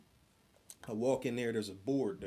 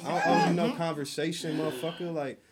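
higher, louder indignant rant; heated retold rant; 5 semitones higher, 5 dB louder than usual. Venting to friends about a coworker, louder heated rant. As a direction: vent it like you're still mad.

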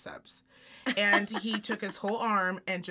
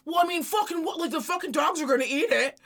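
high, loud flustered protest; hammed-up indignant excuse; defensive husband imitation; 8 semitones higher, 6 dB louder than usual. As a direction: blurt it out as if caught red-handed. Acts husband caught sweeping crumbs.

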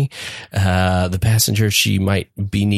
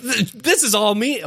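high mock claim; 10 semitones higher than usual. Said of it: "I was like".

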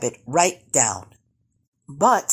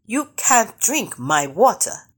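mild shout, slow and higher; bossy raised voice, lightly shouted; 6 semitones higher than usual. A narrator's reading. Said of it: "Brother Rabbit shouted".

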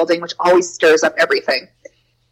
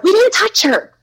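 put-on much higher, childlike protest; kids protesting; 12 semitones higher than usual. Kids insisting to the teacher, much higher and childlike. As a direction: squeak it out like a defensive little kid.

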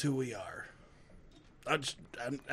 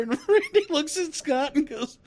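ordinary delivery

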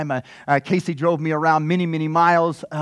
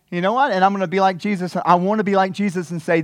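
ordinary delivery

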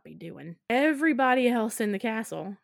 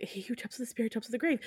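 quick, softer recital; rattled-off recollection; 3 semitones higher, 6 dB quieter, much faster than usual. Retold or imitated. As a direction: run through the warning in a hurry. Same warning recalled in passing.

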